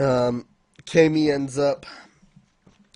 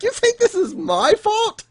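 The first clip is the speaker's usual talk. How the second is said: high-pitched acted outrage; mock indignation. Voicing the accused man's retort, high mock indignation. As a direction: protest in a high, offended voice.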